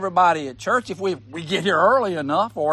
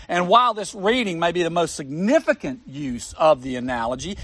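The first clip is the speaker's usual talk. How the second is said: ordinary talk, nothing marked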